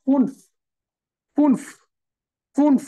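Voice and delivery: "ফুনফ" as one falling syllable closing on f; umlaut demo. Shown as a demonstration. Teacher asks students to listen, "ফুনফ" modelled with umlaut. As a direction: say "ফুনফ" singly with the altered u. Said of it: "উচ্চারণটা different হবে".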